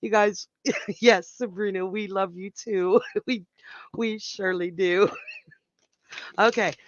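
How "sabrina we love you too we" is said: normal talking voice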